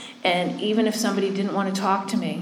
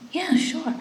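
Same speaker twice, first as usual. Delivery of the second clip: high-pitched retold reply; imitated agreeable reply; 9 semitones higher than usual. The other woman's reply, acted.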